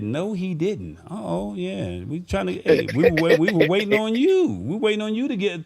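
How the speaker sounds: ordinary talk, nothing marked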